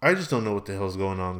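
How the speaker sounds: usual speaking voice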